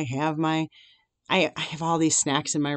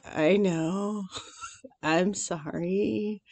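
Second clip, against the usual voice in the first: sheepish apology; 2 semitones higher, 7 dB quieter, much slower than usual. Retold or imitated. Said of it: "And so I was like".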